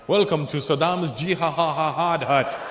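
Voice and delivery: slow mock Iraqi accent announcement; put-on Iraqi accent, drawn-out host's welcome; Iraqi club host accent. Imagined Iraqi comedy club host.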